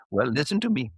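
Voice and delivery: lightly put-on Indian accent, playful guru; a gentle Indian accent, teasing; mock Indian accent. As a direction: Indian accent impression, mild. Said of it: "you're so good at Indian accents".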